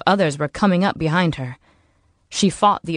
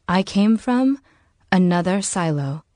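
ordinary delivery, nothing marked

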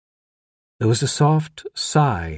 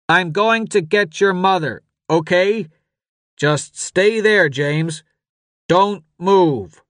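high, loud, urgent yell; yelling father; 9 semitones higher, 4 dB louder, slower than usual. A narrator's reading. Father tells his son to stay put, yelling over din. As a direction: yell to be heard over screams and shouts. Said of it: "His father was yelling now".

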